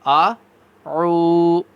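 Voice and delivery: آ عو sounded as two different throat letters; hamza then ain. Shown as a demonstration. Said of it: "ان دونوں کی آواز میں بھی فرق کرنا ہے".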